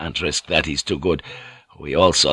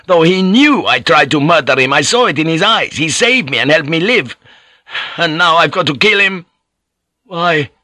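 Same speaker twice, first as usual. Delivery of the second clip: tormented; 8 semitones higher, 4 dB louder than usual. Acted. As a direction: pour out the guilt to the priest. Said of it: "he burst out in torment".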